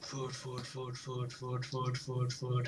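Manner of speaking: very soft, low, monotone chant; whispering in sleep. Acted. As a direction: murmur it hypnotically into a sleeper's ear. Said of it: "Mochi whispering to me in my sleep".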